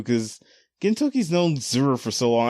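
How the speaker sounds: normal talking voice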